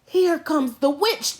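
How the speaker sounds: usual speaking voice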